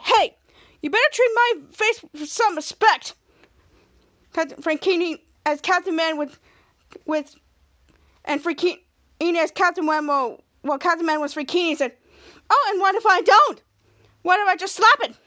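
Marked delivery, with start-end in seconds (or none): 0.0-3.1 high-pitched mock indignation; indignant warning. Captain Man defending his own face, indignant. Acted warning in body-swap quarrel. 12.5-15.1 loud, high, goading challenge; defiant taunt. Acted. Frank threatening to slap the face, defiant taunt.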